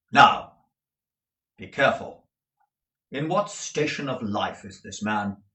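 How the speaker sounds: loud sharp warning; warning lawyer. Acted. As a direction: caution him sharply. Jaggers warning.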